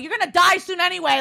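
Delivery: mock yelling. Cyclist telling off an old man, mock yelling. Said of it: "Like, yelling at the bikers".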